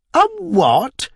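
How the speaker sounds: high, loud shocked shriek. Acted. Landlady shrieking at her husband's marriage hint. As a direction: shriek it in horror. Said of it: "shrieked Missus Tibbs".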